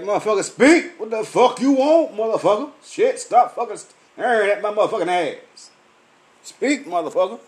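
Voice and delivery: daring staring strangers on Beale Street to speak, loud and heated; angry challenge. Retold or imitated. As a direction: call them out hard. Confronting people staring at him.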